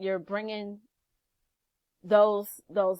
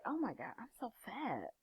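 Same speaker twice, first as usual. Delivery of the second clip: self-critical hush; 15 dB quieter than usual. Pretend. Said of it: "You say".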